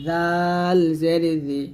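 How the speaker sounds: জাল জের জি, thin and slow; জাল জের জি with a thin, light ز; thin zal. Shown as a demonstration. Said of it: "জালটা পাতলা হবে". (পাতলা জাল, ধীরে)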